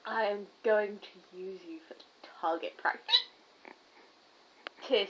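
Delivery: menacing low whisper; threatening whisper. Pretend. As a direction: whisper it like a villain. Mock threat whispered at cameraman.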